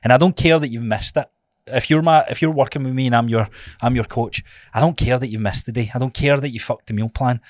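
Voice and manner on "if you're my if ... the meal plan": ordinary delivery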